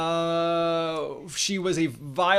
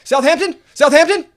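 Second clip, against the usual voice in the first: high, very loud questioning shout, repeated; shrill-pitched mock yelling in separate bursts; imitated screaming; 11 semitones higher, 14 dB louder than usual. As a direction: mimic a frantic woman screaming a place name. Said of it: "just starts screaming at me".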